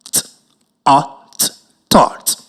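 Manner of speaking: segmented sound-by-sound, "Th-, art, thought." blended at the end. Shown as a demonstration. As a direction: split "Th-" and "art", then join into "thought".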